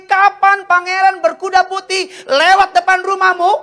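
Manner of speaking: high, loud sustained proclamation; impassioned preaching. Acted. Preacher relaying God's warning to young people, impassioned high proclamation.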